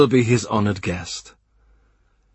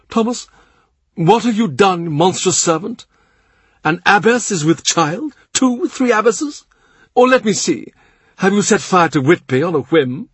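much higher, louder, hammed-up scolding; the Cardinal's mock outrage; 10 semitones higher, 5 dB louder than usual. A narrator's reading. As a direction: tease him with pretend horror.

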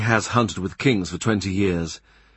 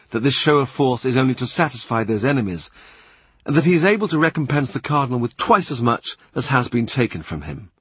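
ordinary delivery, nothing marked

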